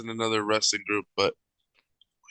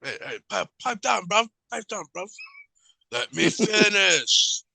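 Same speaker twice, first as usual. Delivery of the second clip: high-pitched mock of Ospreay's accent; 10 semitones higher than usual. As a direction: put on Ospreay's accent, pitched way up, drawing out the last words. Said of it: "but his accent would just".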